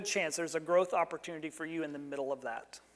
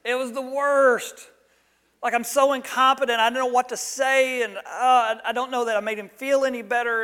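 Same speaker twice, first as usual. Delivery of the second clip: higher, louder, dragging out the misery; self-pitying lament; 5 semitones higher, 7 dB louder than usual. Retold or imitated. Husband telling wife the visit failed, hammed-up despair. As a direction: moan about your own failure. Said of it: "And I said".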